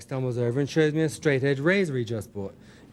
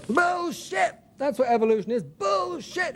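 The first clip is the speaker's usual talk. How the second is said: furious insisting shout; 11 semitones higher than usual. Acted. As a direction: yell it in his face, triumphant and mad. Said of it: "he insists".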